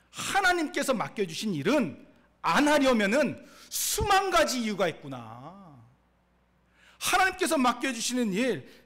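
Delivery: loud, high-pitched rhetorical questions and answers; full-force preaching. Real, not acted. Preacher quizzing the congregation on Moses's excuses, forceful and loud. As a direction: drive each point home forcefully.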